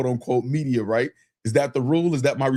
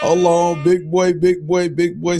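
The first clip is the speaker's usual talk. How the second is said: louder, higher hype for a big super chat; excited loud call; 4 semitones higher, 4 dB louder, slower than usual. Real, not acted. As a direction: yell it like the money just landed.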